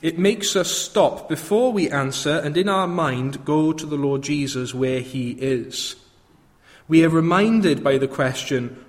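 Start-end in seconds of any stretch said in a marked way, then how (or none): none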